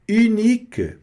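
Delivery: unique with rounded u, high and clear; French unique. Shown as a demonstration. Teacher modelling unique in French, lifted pitch. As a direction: say unique with pouted lips. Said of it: "French u".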